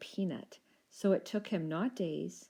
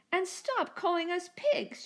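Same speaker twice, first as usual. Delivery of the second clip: much higher, slow indignant squeak; indignant dino shout; 12 semitones higher, 4 dB louder than usual. A narrator's reading. First little dino shouting at the Rex, high and indignant. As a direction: protest hotly, drawing it out. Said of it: "shouted the first little dino".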